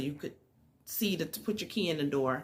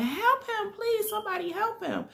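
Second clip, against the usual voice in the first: pleading shriek, pitched way up; screaming for help. Retold or imitated. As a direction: imitate a woman crying out for help. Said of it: "and she's screaming".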